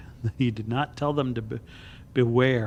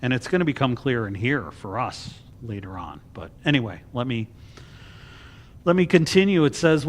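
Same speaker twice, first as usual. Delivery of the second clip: normal talking voice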